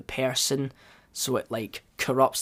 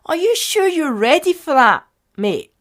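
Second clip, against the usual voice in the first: badly imitated voice, pitched much higher and louder; mock mystery voice; 11 semitones higher, 4 dB louder than usual. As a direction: put on an exaggerated high-pitched voice for the unseen speaker. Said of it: "he didn't sound like that".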